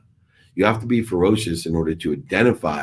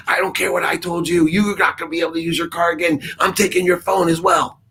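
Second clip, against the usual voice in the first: much higher hammed-up dad cutting in; mock angry dad; 12 semitones higher than usual. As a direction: caricature the yelling dad, pitched up. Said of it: "she would have said".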